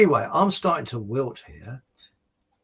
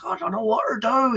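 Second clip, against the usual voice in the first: high-pitched, helpless simpleton voice; village idiot; 12 semitones higher than usual. Acted. Village idiot confiding in the farm workers, dopey and high. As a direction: play a bewildered joke bumpkin, pitched high. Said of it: "a bit of a village idiot".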